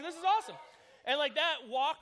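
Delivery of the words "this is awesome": high, loud and laughing; giddy, can't contain it; laughing joy. Retold or imitated. Boy celebrating his first date, laughing loudly. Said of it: "I'm like".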